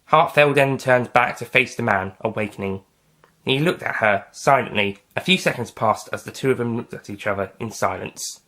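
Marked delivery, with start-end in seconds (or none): none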